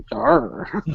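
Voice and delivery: pirate voice. Pretend. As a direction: toss off a jokey pirate yar. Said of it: "a ninja that talks like a pirate".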